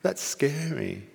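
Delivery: high, slow, mildly worried voice; small self. Acted. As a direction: draw it out like a timid little self. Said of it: "Me, my small self".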